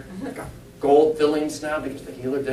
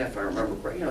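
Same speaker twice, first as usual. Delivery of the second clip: ordinary talk, nothing marked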